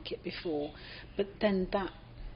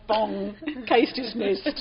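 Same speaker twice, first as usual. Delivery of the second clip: slow, loud mock verdict; mock judge; 4 semitones higher, 8 dB louder, much slower than usual. Judge dismissing the wanker case with a slow loud verdict. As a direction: bang the gavel and pronounce it slowly. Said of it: "The judge goes".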